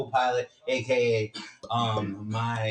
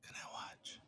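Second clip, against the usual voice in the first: hushed whisper; breathy whisper to a sick friend; 9 semitones higher, 20 dB quieter than usual. Real, not acted. Sick friend in quiet room.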